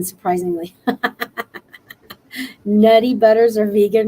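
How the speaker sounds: ordinary talk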